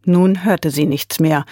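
ordinary delivery, nothing marked

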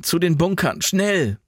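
high, urgent command; calling out. A narrator's reading. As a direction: hurry them to shelter. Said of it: "rief Jan".

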